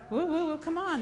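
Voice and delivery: high, half-hearted woo to rouse the crowd; mock cheer. Storyteller mock-rallying the audience, ironic cheer. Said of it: "That was not very exciting".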